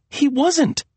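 high, upset denial; insistent. A narrator's reading. Serena correcting Maria about Lester, insistent. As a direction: hold to the denial. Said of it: "Serena insisted".

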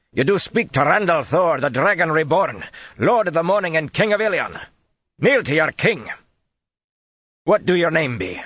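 loud, high proclamation of titles; proclaiming. Acted. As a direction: announce the king like a herald. Gregorin proclaims the king's titles.

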